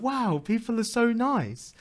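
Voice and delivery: high, slow, mock-weepy wonder; touched, tearful cry. Retelling crying at home after a stranger's help, high tearful wonder. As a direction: imitate crying at someone's kindness, drawn out and high. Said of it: "it was more of a cry of being like".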